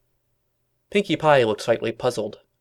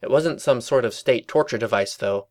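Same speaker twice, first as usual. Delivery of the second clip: ordinary delivery, nothing marked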